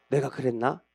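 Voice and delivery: half remembering, unsure; unsure old king. Acted. Frail King David doubting his own promise, hushed. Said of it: "긴가민가 하고 있는데".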